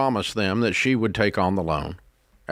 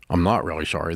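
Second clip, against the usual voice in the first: sarcastic; 7 semitones lower, faster than usual. Real, not acted. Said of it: "That was sarcasm".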